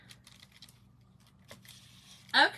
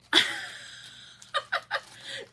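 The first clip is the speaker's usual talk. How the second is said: high airy burst of laughter; a real laugh with no voice behind it; breathless laugh. Real, not acted. Cracking up at a viewer's comment, airy high laugh.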